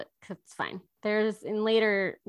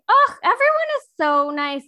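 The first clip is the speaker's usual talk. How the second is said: gushing; 10 semitones higher, 9 dB louder than usual. Retold or imitated. Retelling own gushing reaction.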